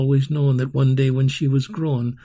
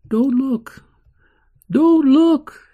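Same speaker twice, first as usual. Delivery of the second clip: high, loud cry, building; 11 semitones higher, 7 dB louder, slower than usual. A narrator's reading. Girl afraid of being seared by a glance, high rising cry. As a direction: cry it out high, louder the second time. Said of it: "she would cry".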